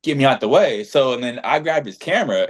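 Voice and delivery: normal talking voice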